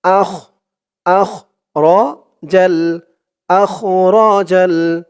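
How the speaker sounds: slow, careful Quran chant; slow recitation. Shown as a demonstration. Teacher reciting the next verse slowly. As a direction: recite each phrase slowly. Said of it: "আপনারাও আমার সাথে তেমনভাবে বলবেন".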